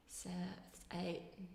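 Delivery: whispered counting to herself; whispering. Real, not acted. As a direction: count under your breath. Counting parts under her breath.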